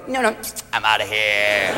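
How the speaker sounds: deep, low dismissive voice; low nature voice. Pretend. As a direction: drop the voice way down.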